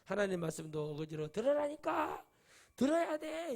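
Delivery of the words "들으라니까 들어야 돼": a hammed-up demand that they listen; forceful yell. Preacher imitating a pushy believer, forcefully yelling. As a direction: pitch jumps high into a mock yell.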